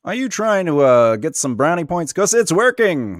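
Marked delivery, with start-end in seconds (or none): 0.0-0.7 radio voice. Pretend. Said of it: "That's why I'm doing the radio voice".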